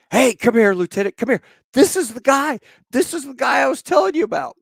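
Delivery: high, loud yell across a room; acted-out excited calling, full voice; excited shout. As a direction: holler for your lieutenant to come see. Sergeant calls his lieutenant over.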